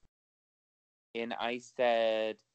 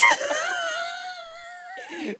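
helpless shrieking laughter. Real, not acted. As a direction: howl with laughter, almost crying. Howling at the punchline.

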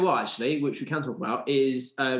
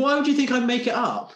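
indignant outburst; 12 semitones higher, 6 dB louder than usual. Real, not acted. Indignant comeback to friends.

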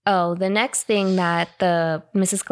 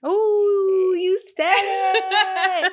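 high, laughing teasing ooh; delighted laugh; 11 semitones higher, much slower than usual. Real, not acted. Reacts to tricky pronunciation.

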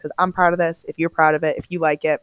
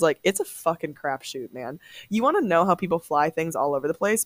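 normal talking voice

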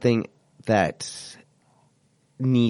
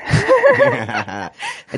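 high laugh; 6 dB louder than usual. Real, not acted.

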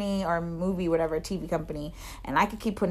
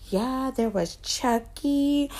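higher, slow, awed recital; imitating little girl; 7 semitones higher, slower than usual. Girl listing horror characters she saw, awed imitation. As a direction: draw out the names after a gasp. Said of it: "and she was like".

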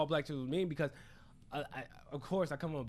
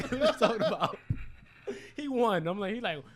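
normal talking voice

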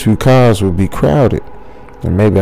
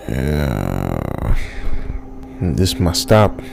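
much lower and soft; deep old man; 8 semitones lower, 4 dB quieter, much slower than usual. Retold or imitated. Old man leaving the train, low and quiet. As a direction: rumble it out like a gruff old man. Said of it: "the man said".

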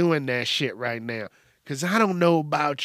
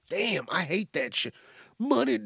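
usual speaking voice

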